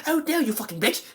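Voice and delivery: very high mock outburst; Mary Shelley. Reviewer mocking the actress's sudden switch, high-pitched hammed-up impression. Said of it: "she goes back and forth".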